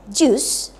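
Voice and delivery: "jus" with a rising tune; rising intonation. Shown as a demonstration. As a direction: lift the pitch through "jus" as a first option. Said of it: "harus menggunakan raising intonation".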